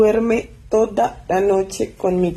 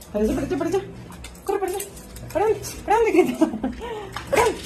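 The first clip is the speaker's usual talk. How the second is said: higher, teasing voice; playful dog talk; 11 semitones higher, 3 dB quieter, much faster than usual. Real, not acted. Owner playing chase with Greta, higher teasing voice.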